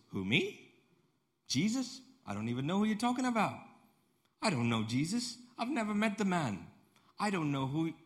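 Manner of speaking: high-pitched protest of innocence; acted, indignant "who, me?" disbelief; incredulous denial. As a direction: play dumb, voice leaping up. Acted Peter denying he knows Jesus.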